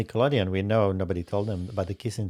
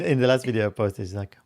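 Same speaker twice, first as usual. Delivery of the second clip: ordinary talk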